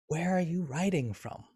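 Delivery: soft inquiring tune, high on "are"; wondering question. Shown as a demonstration. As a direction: ask softly, lifting "are" then falling. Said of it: "with a different kind of tone".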